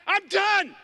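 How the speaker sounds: high, loud, slow declaration; an antelope who won't be hunted anymore; defiant antelope. Pretend. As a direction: plant your hooves and refuse. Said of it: "some antelope got up and said".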